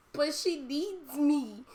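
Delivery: slow, high-pitched plea; mock pleading. Acts own plea to sister.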